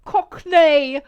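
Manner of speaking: a hint of a Cockney Cockney; Cockney accent. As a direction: try the word in a Cockney accent. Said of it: "I don't know how to".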